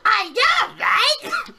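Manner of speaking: put-on very high, loud kid squeal; excited kid. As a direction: shriek it like an overexcited child. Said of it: "And the kid's like".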